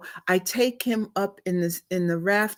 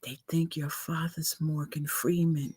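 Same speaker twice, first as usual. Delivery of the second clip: mock whisper; 2 semitones lower, 9 dB quieter than usual. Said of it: "she whispers to me".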